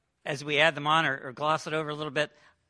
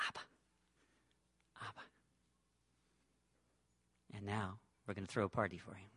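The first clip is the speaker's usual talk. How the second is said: gentle father; 7 semitones lower, 8 dB quieter than usual. Acted. Father gently correcting his son.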